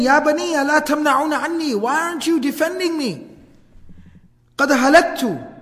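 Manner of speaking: high, urgent plea, stopping short of screaming; mild acted scream. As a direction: imitate a desperate cry for help. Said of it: "he keeps on screaming".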